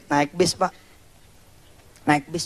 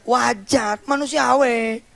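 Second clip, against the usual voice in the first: usual speaking voice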